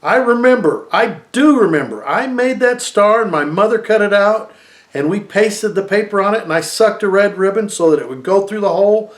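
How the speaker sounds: put-on a boy shouting high and loud, voice pushed up; loud, high desperate child's shout. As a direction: yell it at them, memory bursting out. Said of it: "shouting at them".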